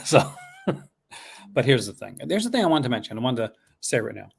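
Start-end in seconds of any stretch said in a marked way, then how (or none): 0.1-0.7 laughing. Real, not acted.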